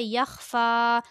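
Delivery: यख्फ़ा with the last "faa" held long; long final alif. Shown as a demonstration. Teacher giving the example word, यख्फ़ा with long ending. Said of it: "फ़ा का खड़ा ज़बर पढ़ा जाएगा".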